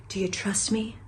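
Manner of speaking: soft, whispered romantic-hero line; playful Aladdin. Pretend. Host playing Aladdin offering a carpet ride, soft whisper. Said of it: "And I'm your Aladdin".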